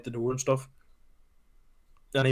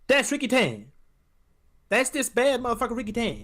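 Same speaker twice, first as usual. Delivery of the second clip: higher, hyped-up comic voice; excited Chris Tucker imitation; 9 semitones higher than usual. Friend imitating Chris Tucker in the spa, excited high voice. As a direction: sell it like a brash sidekick.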